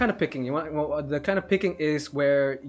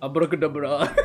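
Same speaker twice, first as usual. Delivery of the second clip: magician flourish. Pretend.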